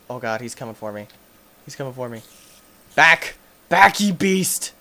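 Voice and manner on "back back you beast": loud, raised battle shout; alarmed shout. Real, not acted. Gamer fending off an approaching spider, loud shout. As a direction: shout it to drive a beast back.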